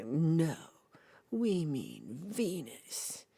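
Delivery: very slow, deliberate correction; patient correction. Retold or imitated. Correcting the Italians, slow and deliberate. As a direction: spell it out slowly like to a child.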